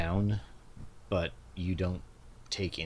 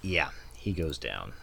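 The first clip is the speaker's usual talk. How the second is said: usual speaking voice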